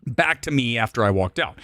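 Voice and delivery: normal talking voice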